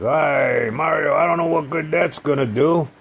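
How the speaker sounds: high, loud mocking doctor voice; imitating the dismissive doctor, high and loud. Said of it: "He said".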